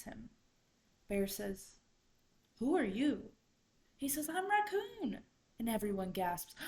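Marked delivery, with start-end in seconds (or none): 2.6-3.3 loud, swooping question; puzzled challenge. A narrator's reading. Bear to the unrecognized raccoon, loud puzzled challenge. 4.3-5.2 high, loud protest; indignant raccoon. A narrator's reading. Raccoon to the council that forgot him, high loud protest.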